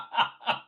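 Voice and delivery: breathless laugh. Real, not acted. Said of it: "I'm laughing again".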